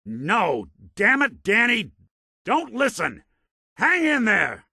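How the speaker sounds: high, loud cries of alarm; alarmed shouting. Acted. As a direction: yell urgently to a companion in danger. Alarmed calls to endangered companion.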